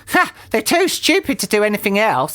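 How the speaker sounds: high-pitched scornful wife; Missus Twit. A narrator's reading. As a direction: scoff in a high character voice. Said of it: "said Missus Twit".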